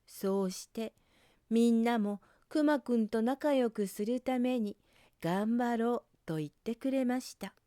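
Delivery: plain reading voice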